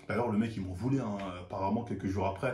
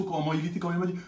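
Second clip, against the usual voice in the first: usual speaking voice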